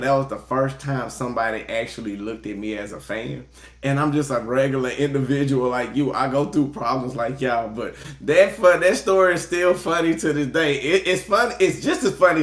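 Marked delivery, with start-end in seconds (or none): none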